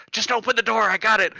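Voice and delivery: high, insistent mock yell; urgent command. Repeats urgent command in story.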